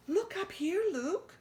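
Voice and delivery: high, bright squeal of a thrilled little mouse; excited squeal. A narrator's reading. Christopher calls up to his cousin, squealing excitedly. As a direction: squeal it out, pointing up with glee. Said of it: "he squealed excitedly".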